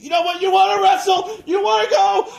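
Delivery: high, loud screaming; wild shout. Retold or imitated. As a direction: scream it fired up, voice cracking. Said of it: "tearing his coat".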